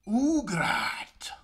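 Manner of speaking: loud, rough holler of the name; hammed-up drawn-out call. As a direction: bellow it across the battlefield. Calling out to a character.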